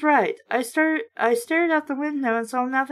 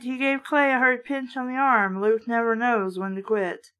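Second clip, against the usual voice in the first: usual speaking voice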